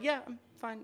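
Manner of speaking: imitated subdued reply, softly. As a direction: softly mimic her muted answer. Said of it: "a little bit lower and a little bit softer".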